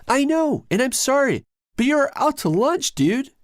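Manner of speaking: high, loud angry retort. A narrator's reading. Timothy scolding his brother, angry. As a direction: snap back at him. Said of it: "Timothy says angrily".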